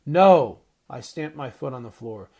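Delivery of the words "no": shouted defiant no; girl refusing to leave, shouted no; loud protest. A narrator's reading. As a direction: yell it and stamp your foot. Girl refuses being sent away.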